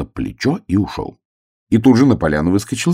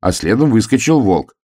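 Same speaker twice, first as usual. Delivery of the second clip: usual speaking voice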